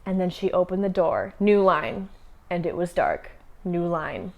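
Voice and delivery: hushed mock-dramatic reading, pausing at each break; mock choppy writing. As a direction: imitate choppy prose in short, separate bursts. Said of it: "was very choppy".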